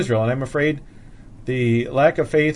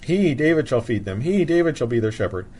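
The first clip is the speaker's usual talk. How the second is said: strong stress on "He, David"; emphatic HE. Shown as a demonstration. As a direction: punch "He, David" each time, then let the phrase fall away. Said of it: "the two he's there are emphatic".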